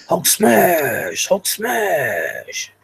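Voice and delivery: hammed-up Hulk battle cry; Hulk smash. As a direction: bellow it like a cartoon Hulk. Hyping viewers to hit like.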